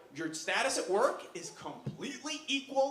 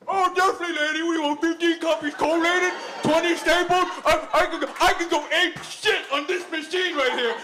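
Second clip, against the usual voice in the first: high, frantic shouting; manic mock eagerness; 9 semitones higher, 14 dB louder, slower than usual. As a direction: shout it like an over-excited copy clerk. Acted eager outburst at the copier.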